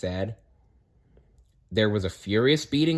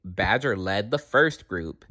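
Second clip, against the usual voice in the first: ordinary delivery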